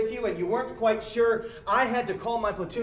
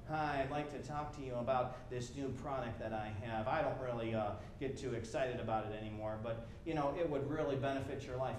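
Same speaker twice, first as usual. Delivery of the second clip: much lower and softer, lifeless sales call; passionless pitch; 8 semitones lower, 10 dB quieter than usual. Retold or imitated. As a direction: sell it with no interest at all. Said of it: "with passionless calls".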